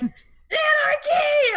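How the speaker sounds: put-on the kids echo it at full volume; kids shouting anarchy. The kids copying their babysitter, shouting it slowly. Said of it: "they were both like".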